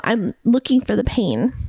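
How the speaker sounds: ordinary delivery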